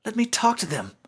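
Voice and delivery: high, loud, eager plea; high eager young pony voice. Acted. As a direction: beg to see them, bursting with hope. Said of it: "I nodded eagerly".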